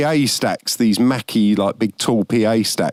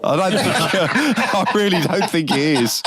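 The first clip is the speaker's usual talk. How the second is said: high, giggling through the words; laughing; 8 semitones higher than usual. Real, not acted. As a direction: laugh while answering. Laughing at a wrong answer.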